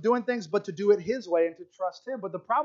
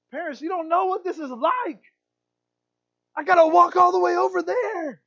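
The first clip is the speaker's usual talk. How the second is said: put-on high-pitched child's complaint, building to loud; whining little kid; 11 semitones higher, 7 dB louder than usual. Little kid complaining to parents about walking, whiny and loud. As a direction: protest like an overdramatic little kid. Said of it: "we're like that little kid".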